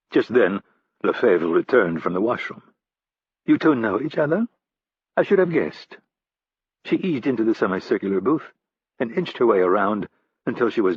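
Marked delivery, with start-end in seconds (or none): 3.4-6.0 higher voiced woman, lightly surprised; woman's voice, amused. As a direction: play the French woman teasing.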